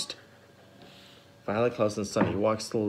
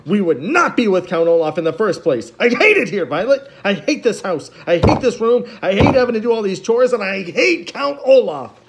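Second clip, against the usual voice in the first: higher, louder angry outburst; rising upset; 10 semitones higher, 8 dB louder than usual. A narrator's reading. Klaus venting to Violet, voice rising in anger. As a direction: build the anger line by line. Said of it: "his voice rising again as he got a little bit more upset".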